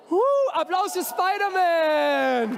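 high, drawn-out cheer. Real, not acted. Host welcoming Spider-Man to the stage, cheering. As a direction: whoop and stretch out the welcome like a show host.

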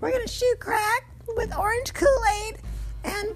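high, airy mocking ditz voice; mock valley girl. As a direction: squeal it like a giddy airhead. Mocking roommate's excited drug plans.

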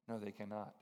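low, soft and grave; quiet grave answer. Retold or imitated. Imam quietly admitting to the pastor, soft and low. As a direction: drop the voice, heavy admission.

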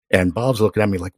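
ordinary talk

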